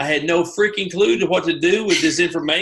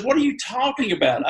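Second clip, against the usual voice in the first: higher incredulous question; 7 semitones higher than usual. Retold or imitated. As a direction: pitch leaps on the second try. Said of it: "She goes".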